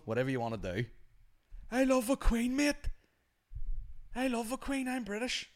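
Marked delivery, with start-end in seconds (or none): 1.7-5.5 very high put-on patriot voice; hammed-up Queen-loving Brit; mock British royalist. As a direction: proclaim it in a silly high voice. Mimics a keen royalist.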